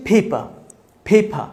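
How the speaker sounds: "ফেইপা" with puffed p, long ei, no r; aspirated P, silent R. Shown as a demonstration. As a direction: drill "ফেইফা" slowly in two syllables, British ending. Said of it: "তাহলে আমরা এটাকে বলবো". ("ফেইফা" ফ দিয়ে, র ছাড়া)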